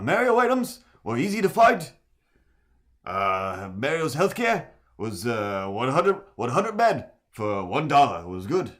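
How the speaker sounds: put-on loud, high, ranting old senator; Bernie Sanders impression. Streamer parodies Bernie Sanders for chat, loud and high. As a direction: hammer it like a fired-up Bernie. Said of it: "the Colbert Bernie Sanders".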